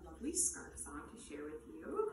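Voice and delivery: whisper. Acted. Whispered intro to kids' song.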